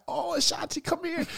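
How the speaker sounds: very high, soft old lady imitation; elderly woman. Old woman calling the radio host in for a hug, imitated high and soft. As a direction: coo it high like an old lady greeting someone dear.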